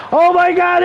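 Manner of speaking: high hyped-up shout; rowdy party animal. Pretend. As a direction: yell it like a ring entrance. Wrestler entrance hype call.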